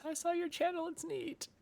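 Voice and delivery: very high, soft mimicking voice; imitating a friend. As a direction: squeak it softly as a teasing impression. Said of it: "hear her saying that in your voice".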